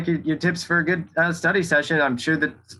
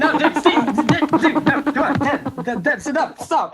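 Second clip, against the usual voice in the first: high squealing laugh-shout; panicked laughing; 13 semitones higher, 3 dB louder, slower than usual. Acted. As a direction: laugh and shriek to make him stop. Ferret pushed in his face.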